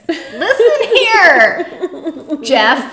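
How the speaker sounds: slow, loud stern mom scolding. Pretend. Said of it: "talking to a cop in a mom voice".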